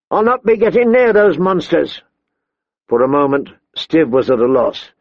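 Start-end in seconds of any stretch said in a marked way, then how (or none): 0.1-2.0 raised-pitch, emphatic refusal; defiant refusal. A narrator's reading. Woman refusing to go near the wolves, defiant. She refuses his order.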